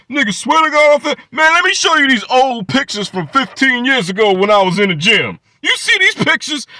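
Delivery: high, loud, fast whiny rant; mock rant. Mimicking Angry Man's rant about his old gym pictures, high and loud. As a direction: ham up a touchy man defending himself. Imitating Angry Man ranting.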